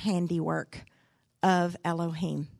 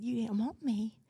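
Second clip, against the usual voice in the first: put-on sad childlike quote; 3 semitones higher, 6 dB quieter than usual. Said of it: "I just looked at her so sad".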